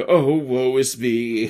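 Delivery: slow, loud melodramatic moan; mock woe. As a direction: ham up the tortured sigh. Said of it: "kind of like".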